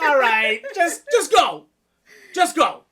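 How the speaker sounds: loud, high, giving-up shout; hammed-up exasperated holler; mock exasperation. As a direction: wave them off as a fed-up ghost.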